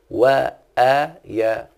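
وآيا said very slowly with the long vowels held; drawn-out vowels. Shown as a demonstration. Teacher checking the class follows, stretching وآيا slowly. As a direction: stretch وآيا out so the class hears each sound. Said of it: "معي إيه؟".

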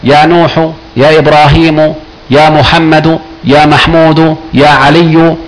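each name ending on a clear -u; "نوح" as nuhu, "محمد" as muhammadu; evenly listed names, "إبراهيم" voiced as ibrahimu; damma endings. Shown as a demonstration. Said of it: "يبقى مبني على إيه؟".

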